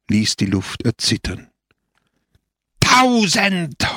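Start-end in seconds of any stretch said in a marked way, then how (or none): 2.8-4.0 very high, sustained crowd howl; soaring, drawn-out shout. A narrator's reading. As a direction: cry it out as a whole crowd would. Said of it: "heulte die Menge".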